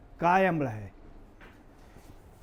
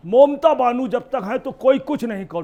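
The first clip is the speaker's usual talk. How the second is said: loud, raised mimicked slogan; higher, forceful imitation of a crowd's boast; slogan shouters; 4 semitones higher, 8 dB louder than usual. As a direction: call it out like supporters shouting a slogan. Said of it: "Bangladeshi जितने नारा लगाते".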